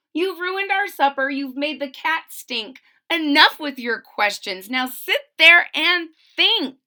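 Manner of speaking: loud scolding, building to a firm order; acted fed-up parent's telling-off; frustrated parents. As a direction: lay down the law to a child, slowing at the end. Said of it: "Her parents were frustrated, frazzled, and mad".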